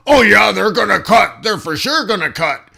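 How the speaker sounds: loud, high, overconfident employee; mock cocky employee. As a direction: blurt it out, smug and sure. Employee cheerfully insists in role-play.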